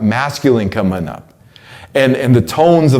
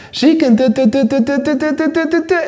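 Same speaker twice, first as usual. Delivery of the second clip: much higher, rapid duh-duh babble; high woman's voice; 14 semitones higher than usual. Shown as a demonstration. Showing how a woman talks up high, high syllable babble. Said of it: "when a woman is up here talking like this".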